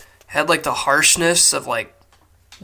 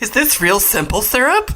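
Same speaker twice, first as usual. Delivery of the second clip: high, put-on fussy lightweight voice; hammed-up squeaky question about a sweet drink; Flitwick; 7 semitones higher, 4 dB louder than usual. Co-host playing Flitwick inspecting his soda water, high mock-giddy voice. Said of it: "Flitwick's a lightweight".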